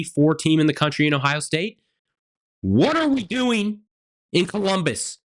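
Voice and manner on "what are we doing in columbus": exasperated. Real, not acted. Rant at team, exasperated.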